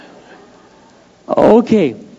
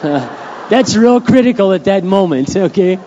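chuckling, a bit higher and louder; laughing aside; 5 semitones higher, 6 dB louder, slower than usual. Real, not acted. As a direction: joke it out through real laughter, slowly and louder. Joking aside while laughing.

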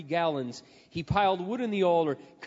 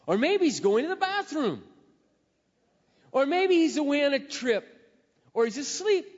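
higher, sarcastic taunts; mocking the silent god; 7 semitones higher, 3 dB louder than usual. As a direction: tease them with each guess. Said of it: "Elijah began mocking them".

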